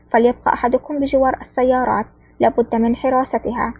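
ordinary delivery, nothing marked

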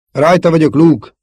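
loud, high reassuring battle shout. A narrator's reading. Wingman coming to Luke's rescue, shouting. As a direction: shout that help is on the way. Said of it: "kiáltotta Wedge Antilles".

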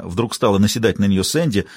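usual speaking voice

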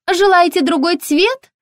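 very high, chirpy shop assistant; high-pitched, ringing sales voice; ringing saleswoman voice. A narrator's reading. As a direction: pipe up brightly through the door. Ringing-voiced saleswoman offers colours.

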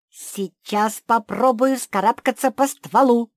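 high, loud and gung-ho; eager exclamation. A narrator's reading. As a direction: burst out with keen excitement. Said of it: "азартно воскликнула самая маленькая мопсиха". (азартный возглас)

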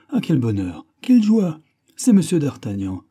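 loud, high-pitched delight; overjoyed, crying out the welcome; joyful cry. A narrator's reading. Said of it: "cria le gros homme". (s'écrier de joie)

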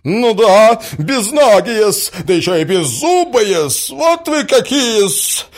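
high, loud, drawn-out jeers; scornful insults. A narrator's reading. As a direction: pile on the abuse at full voice. Woman hurls insults at prince. (злобно, громко обзывая)

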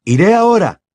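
put-on young man offering at once; eager offer. A narrator's reading. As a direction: jump in keen to help. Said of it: "se ofreció Bill".

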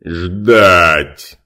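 loud creature bark; booming one-word command. Acted. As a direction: stop him with one word. Creature barks an order.

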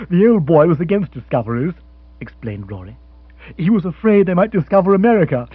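loud, quick joking aside; jolly Rory. Acted. Rory cracking a joke about the old lord, breezily. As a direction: toss off the joke cheerily.

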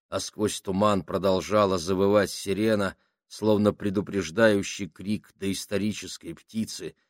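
plain reading voice

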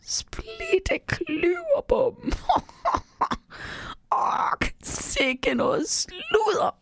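very high, slow squeaky creature voice; tiny teasing voice broken by laughter; mumbling little elf. A narrator's reading. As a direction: chuckle and mutter mockingly, drawing it out. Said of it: "mens han hele tiden mumler".

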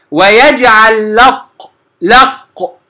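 لق said as lam gliding right into qaf, slow; lam straight to qaf. Shown as a demonstration. Teacher showing students the merged لق, slow. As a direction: go from lam to qaf directly in لق, slowly. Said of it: "أنا خارج من اللام للقاف مباشرة".

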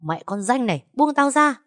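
a beaten rival shrieking to be released; shrieking to be let go. Acted. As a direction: scream at her to let go. Rival screams while being beaten.

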